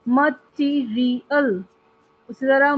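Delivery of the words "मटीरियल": मटीरियल slowly as म-टी-री-यल; four separated beats on मटीरियल; syllable by syllable. Shown as a demonstration. Teacher listing four-syllable words, syllable by syllable. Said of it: "four syllables वाले word".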